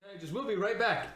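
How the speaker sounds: high mock TV sign-off; announcer voice. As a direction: sign off like a TV host. Mock broadcaster break sign-off.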